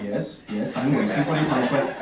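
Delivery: soft, low mock-polite murmur; hushed, restrained politeness, imitated; polite waiting. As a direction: play a very reserved Swedish audience member. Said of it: "they wait politely".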